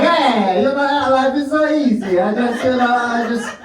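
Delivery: high, hammed-up Italian accent; sing-song mock-Italian accent; pretend Italian. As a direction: play a carefree Italian, pitched right up. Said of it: "I have to pretend to be an Italian".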